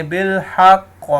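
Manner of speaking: بالحق ending in a bouncing qaf; stopping with qalqala. Shown as a demonstration. Teacher shows the pausal stop on بالحق with qalqala. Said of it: "تو پھر اس کے اوپر جزم لگا دیں گے".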